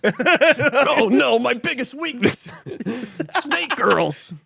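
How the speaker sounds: anime hero. Acted. Hero voice, laughing.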